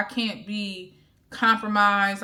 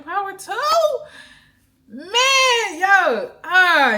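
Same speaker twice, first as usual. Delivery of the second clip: much higher, louder whoops of joy; real excitement; 11 semitones higher, 8 dB louder, much slower than usual. Real, not acted. Vlogger bursting with excitement about God's plan, whooping. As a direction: shout it out, overflowing with joy. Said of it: "hear the excitement in my voice".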